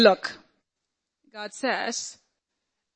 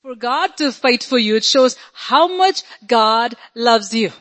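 ordinary talk

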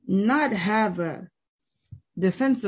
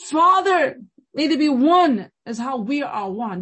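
high, loud cry to the Father, acted out; preacher's impassioned imitation of a plea; imitated crying plea; 6 semitones higher, 6 dB louder than usual. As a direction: call out as Jesus pleading in prayer. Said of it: "he's crying and he's crying and saying".

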